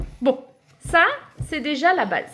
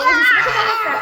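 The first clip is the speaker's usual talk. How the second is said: fou rire; 7 semitones higher, 6 dB louder, faster than usual. Real, not acted. Elle rit, dépassée.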